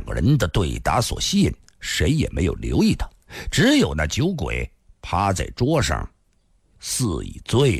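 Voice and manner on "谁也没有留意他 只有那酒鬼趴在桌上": usual speaking voice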